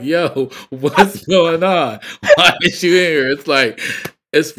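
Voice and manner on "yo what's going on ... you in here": higher, louder, slow shocked call; shocked yell. Retold or imitated. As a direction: yell at him like you can't believe it. Friends shocked at underwear man.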